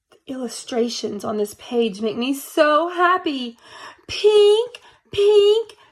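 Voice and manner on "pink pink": high, loud beeping alarm; alarm sound. Acted. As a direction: ring out like an alarm clock. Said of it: "went my alarm".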